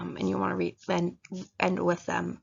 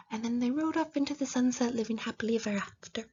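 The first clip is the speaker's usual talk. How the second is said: fairy tale ending; 4 semitones higher, 4 dB quieter than usual. Retold or imitated. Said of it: "I picture of".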